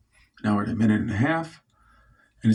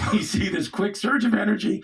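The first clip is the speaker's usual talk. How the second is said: laughing it out; words pushed up through a real laugh; 8 semitones higher than usual. Real, not acted. Comic laughing at the vet's mistake, laughing.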